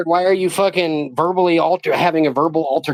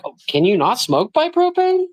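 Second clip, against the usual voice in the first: higher sarcastic mimic of a fussy man; mock prissy question; 8 semitones higher than usual. Mocking the man who complained about propane, high and sarcastic. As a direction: ham up the busybody's whine.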